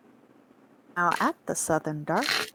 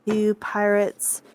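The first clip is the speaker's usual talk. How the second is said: ordinary delivery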